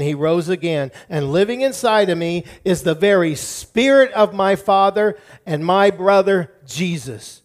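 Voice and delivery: bold, emphatic rebuke to the enemy; confident preacher facing down Satan; defiant declaration. Shown as a demonstration. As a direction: stand your ground and declare it forcefully. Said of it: "I want you to say".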